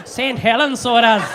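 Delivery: loud, hammed-up Irish accent; mock Irish accent. Comic mocking Ray's accent from Saint Helens, in exaggerated Irish. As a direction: belt it out as a mock Irish lilt. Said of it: "a fucking Irish accent then?".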